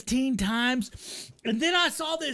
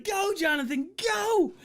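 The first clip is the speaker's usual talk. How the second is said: cheering; 7 semitones higher than usual. Retold or imitated. Cheering again.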